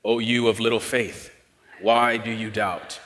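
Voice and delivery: low, soft, emotionless delivery; flat, no emotion. Shown as a demonstration. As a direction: imitate an old movie Jesus, slow and detached. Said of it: "like, flat, like, no emotion".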